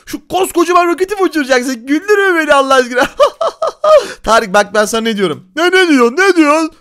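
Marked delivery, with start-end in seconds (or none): 3.1-4.2 high mocking laugh; high teasing giggle. Real, not acted. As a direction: laugh at his big plan. Laughing at big rocket plan.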